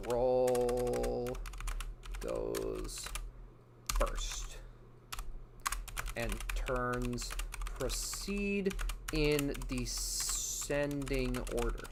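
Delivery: low, slow, lightly done noir narrator; a hint of a hard-boiled detective drawl; [name] noir voice. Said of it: "He's getting into that noir voice".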